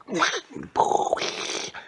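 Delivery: airy high giggle; mock animatronic laugh; high breathy laugh. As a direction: let out a strange laugh. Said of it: "Chica was like".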